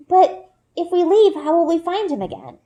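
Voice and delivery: put-on high, worried young girl's voice; a small girl fretting over the lost cub; Runa. A narrator's reading. Little girl Runa asking Bryn about the cub, high and worried. Runa's lines in read story.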